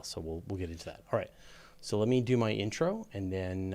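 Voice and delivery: ordinary talk, nothing marked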